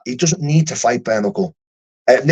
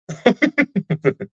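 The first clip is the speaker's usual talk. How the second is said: laugh; 10 semitones higher than usual. Real, not acted. Recalling the smile after his punch, high laugh. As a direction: laugh at the memory, high and light.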